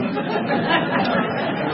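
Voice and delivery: airy high rueful laugh; breathy laugh. Real, not acted. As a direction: let out a silent wheezy laugh. Laughing at shoplifting memory.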